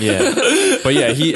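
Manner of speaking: high and loud through laughter; laughing. Real, not acted. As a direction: carry on while still laughing. Recovering from a shared laugh.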